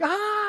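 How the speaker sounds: high mock scream; retold scream. Neighbor's wife finds the bunny, mock scream. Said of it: "she's screaming".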